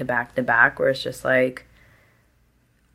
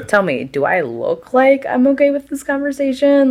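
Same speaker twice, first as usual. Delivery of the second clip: high, sarcastic pleading question; mock exasperated; 11 semitones higher than usual. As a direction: whine it in disbelief. Mock exasperated plea at coworker.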